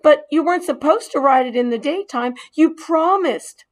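put-on disappointed boy reminding him; mild reproach. Boy reminding the mouse of his promise, reproachful.

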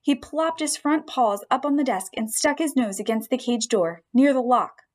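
usual speaking voice

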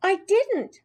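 put-on high, protesting child; high-pitched protest; indignant denial. A narrator's reading. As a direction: deny it hotly. Child denies a yelled accusation.

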